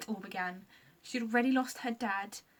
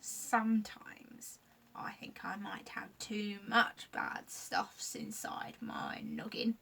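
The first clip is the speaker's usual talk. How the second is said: sad admission; slower than usual. Acted. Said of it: "admitted Scratch".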